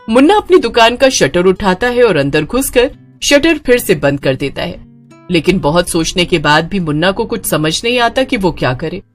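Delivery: plain reading voice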